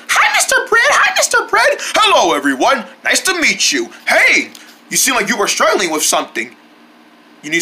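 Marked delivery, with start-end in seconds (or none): 0.1-1.8 very high squeaky chorus-like greeting; little friends greeting. Pretend. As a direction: chirp hello in a tiny voice. Little friends greet Mister Bread.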